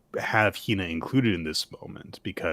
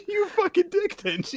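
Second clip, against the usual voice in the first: laughing. Real, not acted. Laughing fit at Denji.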